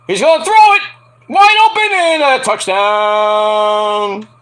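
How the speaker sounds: loud, high burst, then stretched out; excited play call. Real, not acted. Streamer watching the game calls the touchdown excitedly. Reacting live to football play.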